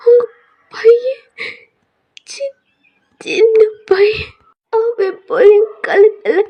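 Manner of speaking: high, loud, slow sobbing begging; sobbing plea. Acted. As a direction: beg with folded hands, breaking into sobs. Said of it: "கும்பிட்டு அழுகுறீங்க". (கையெடுத்துக் கும்பிட்டு அழுதுகொண்டே கெஞ்சுவது)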